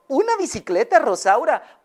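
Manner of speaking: high, disbelieving question; can't believe what she heard; incredulous. Acted. Owner stunned by her hen's wish, high disbelief. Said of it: "se le quedó viendo".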